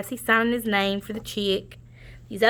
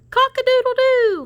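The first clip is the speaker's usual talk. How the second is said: high loud crowing rooster; 5 dB louder than usual. Acted. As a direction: crow it out like a rooster. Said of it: "Rooster crowed".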